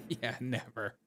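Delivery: "Yeah" hit on its first syllable, then "never" dropping soft; stress on yeah. Shown as a demonstration. Mocking the chat, he stresses "Yeah" first syllable. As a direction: lean into "Yeah" at the start. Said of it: "Emphasis on the first syllable".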